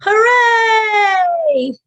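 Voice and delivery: high, loud joyful shout; shouted hooray; big cheer. Shown as a demonstration. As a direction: cheer it out with the kids. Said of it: "let's shout".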